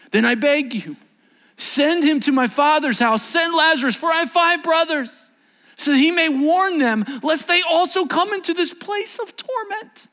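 very high, loud pleading shout; desperate yell. Acted. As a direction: yell it out like a man begging from torment. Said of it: "the rich man yelled out".

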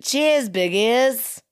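Cheers, biggies in a slow, hammed-up Australian accent; broad Aussie toast, drawn out. Shown as a demonstration. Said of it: "was that not Australian accent?".

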